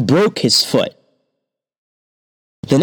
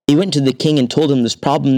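ordinary delivery

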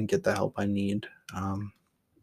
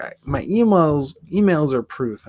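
louder, higher retold protest; mock-exasperated comeback, pitched up; indignant retort; 6 semitones higher, 8 dB louder than usual. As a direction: argue back insistently, raised voice. Retold argument, protesting to publisher.

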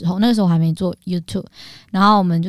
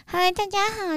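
put-on high, soft baby voice; 嗲声嗲气地打招呼; 娃娃音; 12 semitones higher, 6 dB quieter than usual. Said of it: "娃娃音". (嗲嗲的娃娃音)

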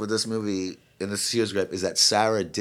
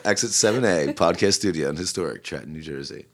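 announcer voice; 7 semitones lower than usual. Pretend. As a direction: lower announcer voice. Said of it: "Love, love your an-, announcer voice".

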